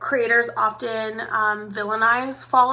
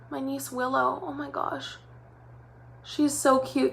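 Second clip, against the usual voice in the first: ordinary delivery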